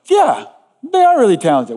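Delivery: high, gushing pretend agreement; mock agreement. Preacher acts out cheerfully agreeing with mom, mock agreement. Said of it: "don't hear that as like".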